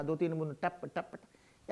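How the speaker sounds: normal talking voice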